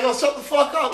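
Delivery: high-pitched mock exasperation; retold anger. Man answering a crying girl, acted exasperated anger. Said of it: "it made me so mad if I see a girl crying".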